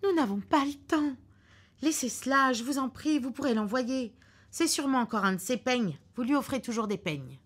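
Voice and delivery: wife pleading, pressed for time; pleading cry. Acted. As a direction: beg him to leave it. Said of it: "s'écria-t-elle".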